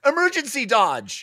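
high frantic cry. Acted. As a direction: panic and dodge. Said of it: "cried Slick Palm".

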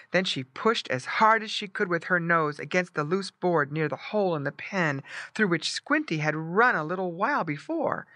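plain reading voice

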